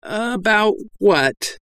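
slow, muffled through hands. A narrator's reading. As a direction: talk through cupped hands. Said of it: "spoke through his hands".

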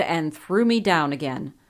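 ordinary delivery

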